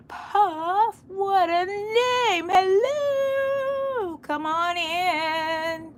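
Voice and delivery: very high, stretched singsong greeting; playful welcome. Real, not acted. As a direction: coo a long, high welcome to a newcomer. Playfully welcomes a new viewer.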